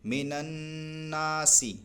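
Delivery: slow recitation with the alif in الناسی stretched long; slow Quranic recitation; drawn-out recitation. Shown as a demonstration. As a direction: chant من الناسی slowly, holding the long a. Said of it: "کھینچ کر پڑھیں گے".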